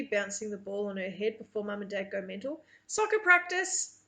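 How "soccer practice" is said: playful announcement. A narrator's reading. Brother announces game to sister.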